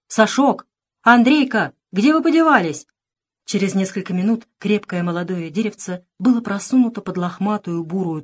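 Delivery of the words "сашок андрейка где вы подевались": higher, louder anxious call; alarmed calling. A narrator's reading. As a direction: call out for missing children. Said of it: "встревоженно повторял". (встревоженно зовёт)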